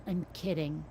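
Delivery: usual speaking voice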